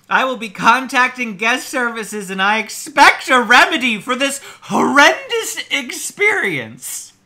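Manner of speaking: high, loud, pompous complaint reading; theatrical outrage building to the end; mock indignation. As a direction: declaim it like an outraged customer, overdoing every stress.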